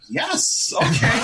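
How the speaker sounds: high, eager yes. Retold or imitated. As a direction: jump at the offer. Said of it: "I was like".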